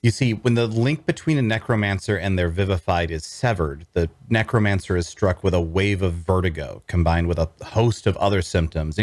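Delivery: plain reading voice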